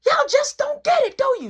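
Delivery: imitating a mother's rising scolding, ending very high and loud; acted-out mom losing patience; yelling mom. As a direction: build to an exasperated parental yell. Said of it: "So now she yelling".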